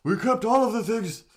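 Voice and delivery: put-on high, loud crotchety old-timer; old man voice. Streamer attempts an old man voice for chat, loud and high. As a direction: rant like a cranky grandpa. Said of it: "try to do old man voice".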